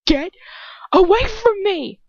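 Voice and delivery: high, loud, slow scream. A narrator's reading. Trapped girl screaming at her attacker, high and loud. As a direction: scream it in terror. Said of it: "I scream".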